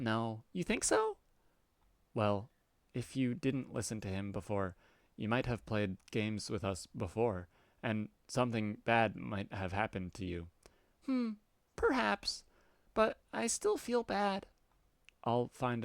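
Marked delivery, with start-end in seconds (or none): 0.5-1.2 put-on much higher, childish defiant cat girl. Cat girl refusing the troll's order, high and childish. 11.1-14.5 put-on much higher, childish defiant cat girl. Cat girl refusing the troll's order, high and childish.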